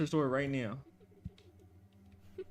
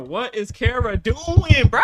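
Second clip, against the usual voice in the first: exasperated; 3 semitones higher, 9 dB louder, slower than usual. Real, not acted. Exasperated at teammate.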